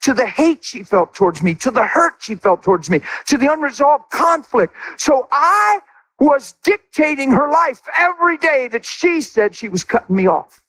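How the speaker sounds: high, driving pulpit preaching; impassioned sermon delivery; full-force preaching. Acted. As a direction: preach it hard to the congregation.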